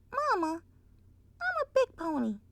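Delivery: put-on high, tiny proud child; sweet little filly voice. Applejack calling Twilight mama, high sweet filly. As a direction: say it like a proud baby pony. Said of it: "sweet little filly voice".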